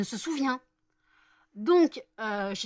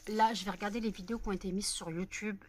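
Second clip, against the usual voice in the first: usual speaking voice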